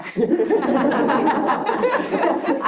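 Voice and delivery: loud burst of laughter, falling away; big real laugh. Real, not acted. Speaker laughing at her own prison joke, loud real laugh.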